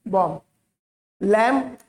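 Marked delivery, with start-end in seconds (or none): 0.1-0.4 बॉम्ब said as "bom", falling; silent b. Shown as a demonstration. Teacher confirming the b is gone, silent b. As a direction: end बॉम्ब on m, leaving off the b. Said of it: "बा नहीं आएगा इसमें".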